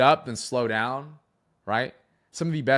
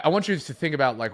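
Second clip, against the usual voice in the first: normal talking voice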